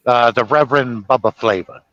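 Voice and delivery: ordinary talk, nothing marked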